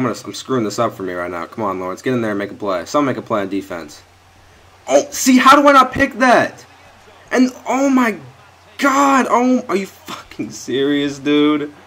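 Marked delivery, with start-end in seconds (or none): 4.9-11.7 high, loud anguished outcry; real exasperated yell; frustrated disbelief. Real, not acted. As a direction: groan it out at the screen. Missed interception reaction.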